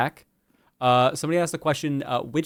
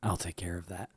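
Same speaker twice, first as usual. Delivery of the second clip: low cold voice; 5 semitones lower, 7 dB quieter than usual. Acted. Cold creature answers dying girl.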